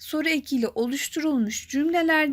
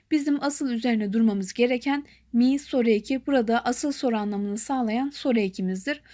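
ordinary talk, nothing marked

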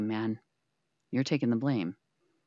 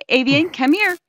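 higher, louder calling; raised calling voice; 9 semitones higher, 7 dB louder than usual. Real, not acted. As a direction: call the cat firmly.

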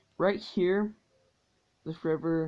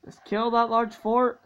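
ordinary talk

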